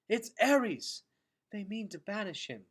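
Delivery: a high, breathless alarm; gasped. A narrator's reading. Luxa running with Gregor down the corridors, gasping. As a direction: gasp it out mid-sprint. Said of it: "gasped Luxa".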